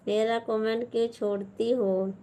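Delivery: ordinary delivery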